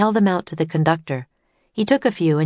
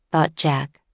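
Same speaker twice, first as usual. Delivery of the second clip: usual speaking voice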